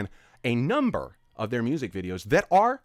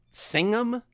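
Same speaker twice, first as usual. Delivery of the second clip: "Singham?" thrown up high in disbelief; incredulous; 11 semitones higher than usual. Real, not acted. Mocking T-Series for uploading an old film song, incredulous "Singham?". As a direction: ask "Singham?" as if it can't be true.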